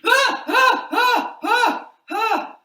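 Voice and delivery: high, loud yelps of fright; mock panicked yelps; startled jump. Shown as a demonstration. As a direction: yelp as if your tail hit you. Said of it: "this is how I would do it".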